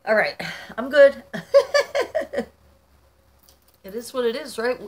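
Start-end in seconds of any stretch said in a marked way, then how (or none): 0.1-1.2 loud, higher voice breaking into laughter; laughing through tears. Real, not acted. Vlogger reassuring viewers after crying, laughing. 1.3-2.4 loud, very high laugh; relieved laugh. Real, not acted. Vlogger recovering from tears before viewers, laughing. Laughing off her own crying.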